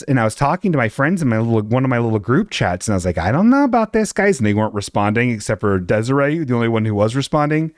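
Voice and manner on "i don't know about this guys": whiny worry. Retold or imitated. Quoting her group chat message.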